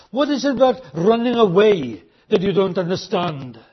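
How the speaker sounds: ordinary talk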